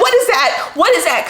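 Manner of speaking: sharp high yells of pain and alarm; panicked scream. Retold or imitated. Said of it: "Oh, I screamed".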